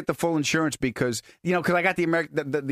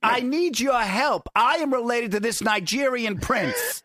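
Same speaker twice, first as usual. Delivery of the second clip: pleading scammer; 8 semitones higher than usual. Pretend. Woman at the ATM begging help for Nigerian prince, mock pleading. Acts out a scam plea at ATM.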